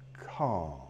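very low, soft, drawn-out word; low soothing calm. Shown as a demonstration. Trainer soothing his dog, very low and soft. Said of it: "projecting calm".

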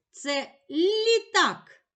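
slow, high and loud reveal; announcing the answer. Shown as a demonstration. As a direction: draw out the answer proudly. Teacher reveals riddle answer.

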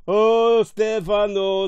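loud, high, drawn-out call; overjoyed cry. A narrator's reading. Fool greeting his lost friend, overjoyed cry. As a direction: draw the name out in delight.

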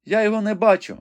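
high-pitched frightened maiden at the window; young woman Rebecca, anxious. A narrator's reading. Rebecca tells the wounded knight what she sees, high and anxious.

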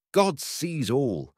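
very slow, solemn appeal. Acted. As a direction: weigh every word.

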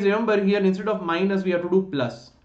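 ordinary delivery

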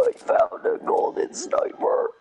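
high, breathless excited find; excited gasp. Real, not acted. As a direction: gasp and blurt out the lucky find. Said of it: "I'm out of my demon voice".

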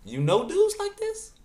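squeaky high, slow disbelief; high stretched outrage; incredulous. Real, not acted. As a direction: shriek it in disbelief.

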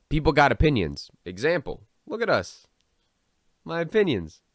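mocking quote. Mocking the writer's words.

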